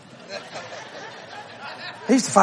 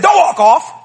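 higher and louder, ordering him back; stern call out; 8 semitones higher, 5 dB louder, slower than usual. Retold or imitated. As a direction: snap it at his back. Confronting a person walking away.

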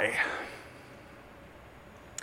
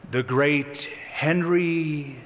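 preacher's soliloquy; slower than usual. Acted. Preacher addressing himself before the visiting king, theatrical soliloquy. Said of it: "he performed a little soliloquy".

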